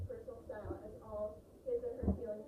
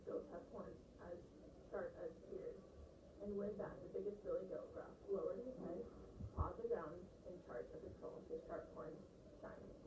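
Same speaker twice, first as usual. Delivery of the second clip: voiceless read-aloud; 5 dB quieter than usual. Shown as a demonstration. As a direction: read it with no expression at all. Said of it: "I was mumbling".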